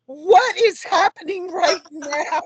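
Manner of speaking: high, loud, drawn-out mock freak-out. Young woman hears both dads share a name, freaking out. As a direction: act out overwhelmed disbelief, slow and high with a laugh. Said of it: "like, freaking out".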